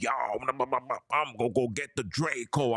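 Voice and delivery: mock hothead muttering a threat; imitating angry dude. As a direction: play the hothead talking himself up. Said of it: "talking to himself, like".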